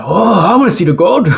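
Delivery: high, sing-song mock excitement; mock eager onlookers. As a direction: play the crowd dying to see it. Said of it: "They'll all be like".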